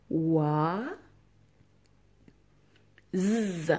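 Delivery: long held "Oa", pause, buzzed "z"; sounding out. Shown as a demonstration. Teacher correcting oiseau sound by sound, sounding it out. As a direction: separate "Oa" from a sustained z. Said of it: "celui qui est coincé entre".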